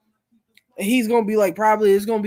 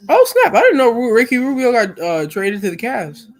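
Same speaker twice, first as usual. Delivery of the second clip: high, loud burst easing down; real surprise; 3 semitones higher, 8 dB louder than usual. Real, not acted. Streamer reacting live to news of a player trade, surprised. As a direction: blurt it out at startling news.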